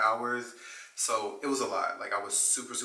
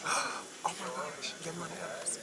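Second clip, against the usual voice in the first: awed hushed whisper; starstruck, barely breathing it; breathless whisper; 7 semitones higher, 6 dB quieter than usual. Retold or imitated. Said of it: "didn't say that out loud".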